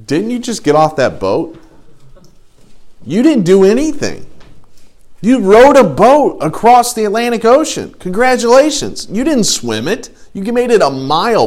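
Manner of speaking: high-pitched incredulous put-down; sarcastic, exaggerated disbelief; mocking heckler. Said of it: "some of you are gonna go".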